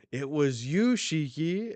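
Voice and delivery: high, loud acted accusation. Wang Zhi points at the culprit, loud accusation. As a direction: burst out accusingly, rising on the name.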